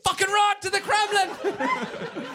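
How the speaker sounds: very high, slow hammed-up shriek; mock shriek. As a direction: squeal it in fake terror. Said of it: "I'm like".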